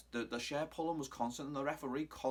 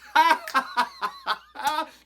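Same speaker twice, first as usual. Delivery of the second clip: loud high cackle; high burst of laughter; 13 semitones higher, 11 dB louder than usual. Real, not acted. Laughing at his impression.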